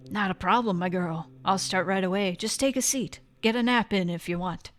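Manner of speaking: ordinary talk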